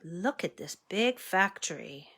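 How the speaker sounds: ordinary delivery, nothing marked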